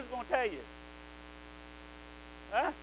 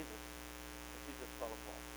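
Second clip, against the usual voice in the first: hushed, slowed down; 9 semitones lower, 16 dB quieter, much slower than usual. A narrator's reading. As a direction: sink to a hush, slow and soft. Stewardess breaking down in story.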